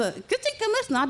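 high broken-record Iki; high malfunctioning mock voice; mimicking Iki. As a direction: pitch way up, glitching. Iki stuck repeating like broken.

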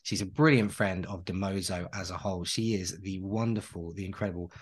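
normal talking voice

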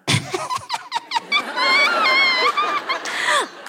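high whooping laugh. Real, not acted. Excited yell before go chant.

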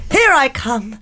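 usual speaking voice

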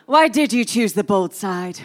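usual speaking voice

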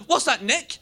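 imitating a rowdy teenage lad; mock lad shout. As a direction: taunt a mate across the bus. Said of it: "Shouting that really loud".

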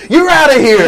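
high, loud laughing yell; hammed-up bouncer throwing her out; mock bouncer shout. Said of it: "He goes".

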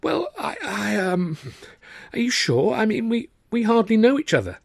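high, halting and nervous; flustered hesitation. A narrator's reading. Arthur to Tilly on marrying, flustered and high. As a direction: fumble for words.

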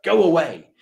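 corrected retelling of the boss, an easy "Go away"; gentle go away. Retold or imitated. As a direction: dismiss him without any harshness. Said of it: "It wasn't hard. It was like".

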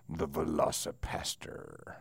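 hushed, slow, spooky title read; dramatic whisper. Pretend. Host teasing listeners with a whispered movie title. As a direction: breathe the title like a horror trailer.

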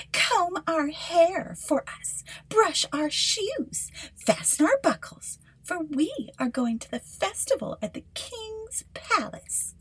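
bossy stepsisters. Acted. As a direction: command her like spoiled sisters. Stepsisters ordering Cinderella.

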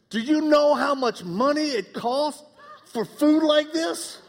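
high, slow mock-outraged grown son; indignant son. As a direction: whine about grocery prices like a new adult. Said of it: "he turns around and he goes".

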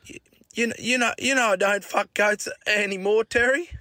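high stammered plea; imitating a stutter. Tommy pleading with Dad, high stammer. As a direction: pitch up and stammer. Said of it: "had a stutter".

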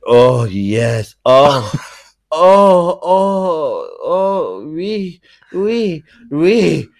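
loud, high mock-orgasmic French; hammed-up French lover building to climax; French accent. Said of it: "you're talking like, uh, the French".

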